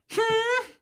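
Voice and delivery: tight, very high "hmm"; high-pitched hum. Retold or imitated. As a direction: answer with a squeaky little hum. Acted sulky reply to dad.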